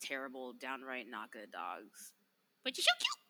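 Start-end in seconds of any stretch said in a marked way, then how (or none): 2.6-3.2 very high, cooing pet voice. Real, not acted. Cooing at the dog.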